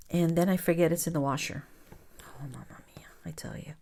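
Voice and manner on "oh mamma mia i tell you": breathy exasperated whisper; whispered sigh. Real, not acted. Whispering to viewers about forgetting the laundry. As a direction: whisper it to yourself, defeated.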